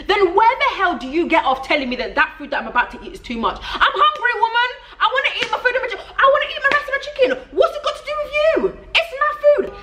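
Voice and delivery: very high-pitched comic tirade; acted exasperation, climbing to a high squeal; indignant outburst. Diner to the woman judging the plate of food, indignant outburst.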